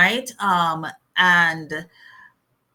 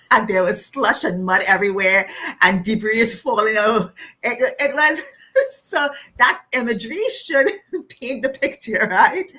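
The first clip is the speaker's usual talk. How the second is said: laughing through words; 4 semitones higher, 5 dB louder than usual. Real, not acted. Laughing through hurricane imagery.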